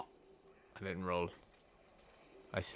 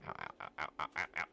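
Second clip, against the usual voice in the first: usual speaking voice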